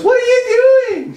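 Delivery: very high, loud falsetto; drawn-out imitation of a scandalized friend; mock appalled shriek. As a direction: screech it in hammed-up disbelief.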